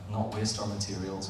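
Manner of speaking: normal talking voice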